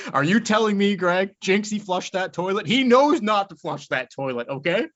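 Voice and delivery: mock outraged interrogation; Robert De Niro. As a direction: imitate an incredulous father-in-law. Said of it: "De Niro teaches the cat".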